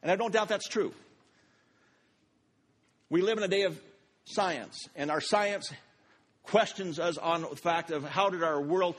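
preaching. Acted. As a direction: preach it to the room. Preaching to congregation.

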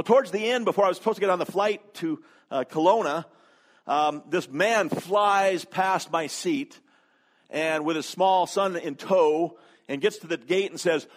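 ordinary talk

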